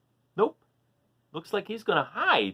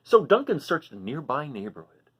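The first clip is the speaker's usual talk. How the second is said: ordinary delivery, nothing marked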